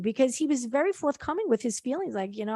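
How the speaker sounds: normal talking voice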